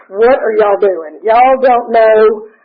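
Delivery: slow, drawn-out incredulous scolding; mock disbelief. Slow and scolding to the careless Tallahassee members. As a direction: stretch it out like you can't believe them. It was like.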